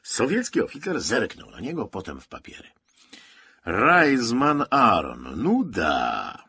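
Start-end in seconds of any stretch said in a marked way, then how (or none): 3.6-6.4 loud, very drawn-out name call; slow, ponderous officer's voice, raised high; slow reading-out. A narrator's reading. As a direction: stretch each word like an official reading papers. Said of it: "rzekł powoli".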